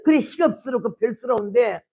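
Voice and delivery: ordinary delivery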